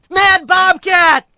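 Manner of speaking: high, drawn-out alarm cry; warning shout. A narrator's reading. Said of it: "he shouted".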